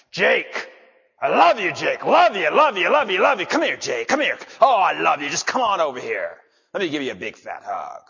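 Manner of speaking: loud, harsh shouting of loving words; angry tone. Shown as a demonstration. Preacher to his dog, angry mean shouting. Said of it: "a mean look on my face and anger in my tone".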